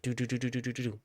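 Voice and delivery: rapid door-opening sounds. Retold or imitated. Storyteller showing viewers how the dream doors opened, rapid imitated noise. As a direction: imitate doors flying open one after another. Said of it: "opening quick like this".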